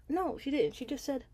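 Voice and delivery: irritated telling-off. Real, not acted. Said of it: "that irritated me".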